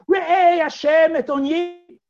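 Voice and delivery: loud, high, drawn-out plea; calling out. Acted. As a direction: cry out to heaven, slowly and loudly. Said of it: "when she called out to him".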